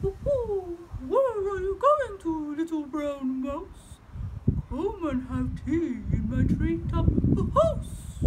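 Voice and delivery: slow, hooting owl voice. Acted. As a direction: invite the mouse like a slow wise owl. Owl voiced in read story.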